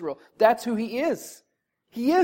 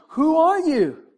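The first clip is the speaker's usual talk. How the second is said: higher, louder mock indignation; incredulous challenge; 9 semitones higher, 6 dB louder, slower than usual. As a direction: throw the question back like an offended Pharisee. Mimicking Pharisees challenging Jesus.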